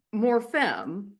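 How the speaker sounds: usual speaking voice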